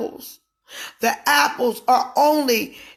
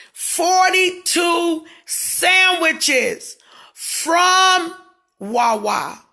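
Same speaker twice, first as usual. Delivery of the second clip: sudden loud drawn-out shout, higher; excited shout; 5 semitones higher, 6 dB louder, much slower than usual. Real, not acted. Telling viewers about the big order, a loud excited shout. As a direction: belt it out in disbelief.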